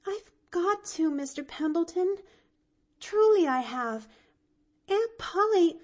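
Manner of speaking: put-on high, pleading young girl; anxious child apologising; distressed little girl. A narrator's reading. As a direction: insist though it hurts. Pollyanna torn, explaining.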